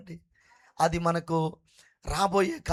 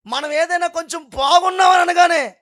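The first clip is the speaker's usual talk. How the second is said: higher, louder preacher's shout; fiery preaching; 8 semitones higher, 7 dB louder than usual. Acted. Preacher shouting to the congregation, fiery preaching. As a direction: hammer it home to the congregation. Preacher rising to full force.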